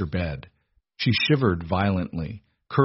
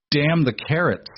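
a higher, sharp burst of anger; snapped; 7 semitones higher than usual. A narrator's reading. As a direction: bite it off in exasperation. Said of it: "Arthur snapped".

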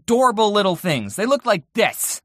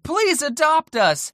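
pleading sign voice; 8 semitones higher, slower than usual. Pretend. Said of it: "piece of paper ... that said".

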